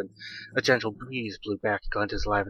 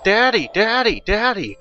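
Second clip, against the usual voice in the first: put-on narrator's high, louder child cry; child's scream; 11 semitones higher, 5 dB louder, slower than usual. A narrator's reading. Frightened girl screaming for her father, high cry. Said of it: "Glinda screamed".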